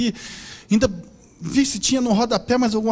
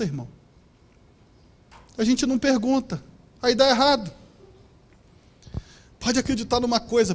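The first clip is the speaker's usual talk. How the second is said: normal talking voice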